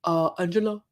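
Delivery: lightly attempted African accent; a weak stab at a hostage with an African accent; half-hearted African-accented hostage; Michael. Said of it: "reenact Michael as a hostage with his African accent".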